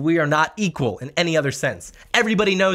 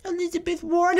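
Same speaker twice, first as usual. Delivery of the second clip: put-on much higher whiny childish voice; whiny answer. Mocking opponents to viewers, answering in a high childish whine. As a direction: squeak the answer like a naive kid.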